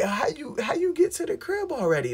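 incredulous question. Retold or imitated. Disbelieving question to the girl.

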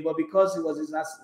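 normal talking voice

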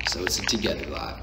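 soft whispered aside; hushed aside to the viewers. Real, not acted. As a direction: drop to a whisper.

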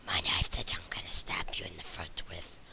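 low, strained menacing whisper; creepy whisper. Acted. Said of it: "My throat actually hurts when I do that".

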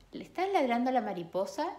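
quick curious question to the dog; girl asking. Acted. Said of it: "girl asking the dog". (niña preguntando rápido con voz aguda)

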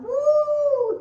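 high, loud joyful whoop; worship whoop. Retold or imitated. Mom whooping in worship in the car. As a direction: let out a praise cry. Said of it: "'Cause I'm like".

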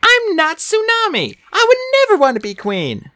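high, emphatic character voice; decided high-pitched protest; firm. A narrator's reading. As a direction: insist in a high voice. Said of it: "Sunny said firmly".

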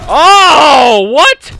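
loud shocked oh, then a very high what; jolted by a sudden kill; real surprise. Real, not acted.

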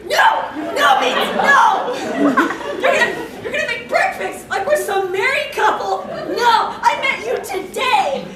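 shouting no, half laughing; loud flustered refusal through laughter; loud laughing protest. Real, not acted. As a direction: yell it in disbelief. Protesting breakfast plan.

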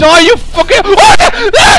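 high, loud frantic yelling; scared swearing climbing into screams; panicked cursing. Real, not acted. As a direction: curse in a rising shriek. Attacked in game.

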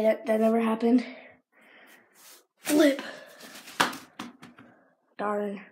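ordinary talk

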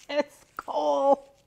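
loud, high, drawn-out burst of amusement; laughing exclamation. Real, not acted. Finding a naughty gift, loud amused outburst. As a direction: crack up as you blurt it out.

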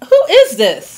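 indignant demand. Acted. Guest demands answer.

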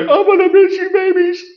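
high, mock-tearful voice for the wife; tearful goodbye. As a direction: imitate her crying over the cats, pitched high. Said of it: "Wendy cried".

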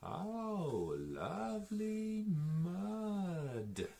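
high, slow, drawn-out pig voice; pig character. A narrator's reading. Storyteller voices the pig admiring mud, high slow pig voice. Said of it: "says the pig".

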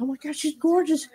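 a moment of mom's gasping delight; mom gushing. Retold or imitated. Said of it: "She goes".

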